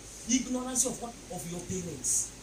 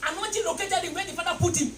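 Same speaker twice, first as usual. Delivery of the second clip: shouted sermon, rising with the crowd; preaching at full force; 8 semitones higher, 16 dB louder than usual. Acted. Preacher rousing the congregation, shouting. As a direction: preach it loud over the music.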